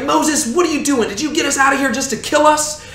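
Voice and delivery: mock panicked crowd; panicked people. Israelites panicking at the sea.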